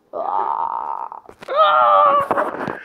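high shrieking yell; playful scream. Pretend. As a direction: shriek as if falling inside. Screams entering the mouth.

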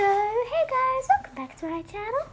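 high, put-on YouTuber accent; fake accent. Shown as a demonstration. Demonstrating fake accent to viewers, high put-on accent. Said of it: "So that's my fake one".

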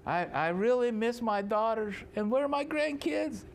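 high, weepy voice rising to a wail; mock tearful pleading. Laban asks Jacob after daughters and grandkids, mock tearful pleading. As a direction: imitate a father missing his children. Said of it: "he, he's crying".

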